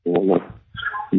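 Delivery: usual speaking voice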